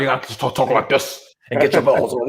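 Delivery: low, soft impression of a Scottish film star; Sean Connery. Shown as a demonstration. Podcaster coaching his co-host on the accent, low Scottish actor impression. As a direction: drop it down into a hammed-up Scottish actor voice. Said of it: "you gotta get that Sean Connery".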